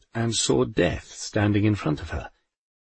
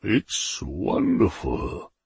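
Almighty's voice; 4 semitones lower, 3 dB quieter, much slower than usual. A narrator's reading. The Almighty admires his work.

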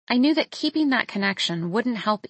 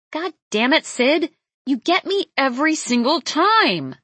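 high, louder, exasperated yell; yell through gritted teeth; 6 semitones higher, 5 dB louder than usual. A narrator's reading. Startled woman scolding a raccoon in the bin, gritted-teeth yell. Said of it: "I yell through gritted teeth".

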